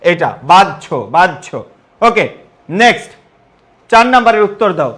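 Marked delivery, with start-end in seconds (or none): 0.4-1.5 "বাহ্য" said baj-jho, falling lower; correct pronunciation. Shown as a demonstration. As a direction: model "বাহ্য" as two beats, baj then jho. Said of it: "সঠিক উত্তর কোনটা বলো তো?".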